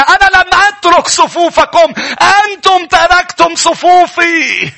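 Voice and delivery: rising sermon cry, high and loud by the finish; building preaching shout. Acted. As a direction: preach it up into a fervent shout, slowing at the end.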